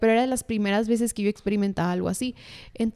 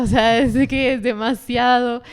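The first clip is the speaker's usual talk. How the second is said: laughing; 3 semitones higher, 8 dB louder than usual. Real, not acted. Laughing at the memory.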